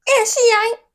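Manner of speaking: high, loud sassy jab; sassy aside. Real, not acted. Host butting in on the couple, loud and sassy. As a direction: snap it at the screen. Snaps back at the screen.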